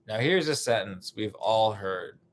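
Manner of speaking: ordinary delivery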